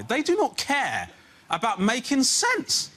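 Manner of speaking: slowed, drawn-out words, exasperated; slow, exasperated parent; slow exasperated emphasis. Real, not acted. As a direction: spell it out slowly in disbelief. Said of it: "slow emphatic rant".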